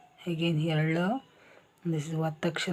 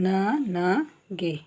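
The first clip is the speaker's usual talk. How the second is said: slow demo; much slower than usual. Shown as a demonstration. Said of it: "ಗ plus A".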